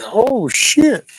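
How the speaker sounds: high squeaky startled voice; imitating a startled lizard. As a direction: play the spooked critter. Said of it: "It was like".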